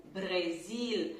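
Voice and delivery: Brasil said slowly as /bɾezil/, English vowel; slow English-style Brasil. Shown as a demonstration. Teacher imitating an English Brasil, slowly. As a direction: Brasil with an open e and a clear L. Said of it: "ou Brasil".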